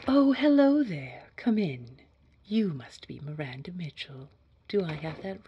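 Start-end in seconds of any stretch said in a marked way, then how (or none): 0.1-2.0 bright greeting. Acted. Greets the arriving candidate aloud.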